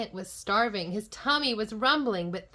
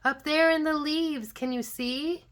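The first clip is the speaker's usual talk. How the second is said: put-on high, loud children's call; excited yell; 7 semitones higher, 6 dB louder than usual. A narrator's reading. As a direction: yell to point something out, easing off after. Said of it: "and they yelled".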